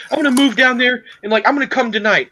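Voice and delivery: hammed-up panicky mom; imitating worried mom. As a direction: mimic an anxious mother rushing over. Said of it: "she's like".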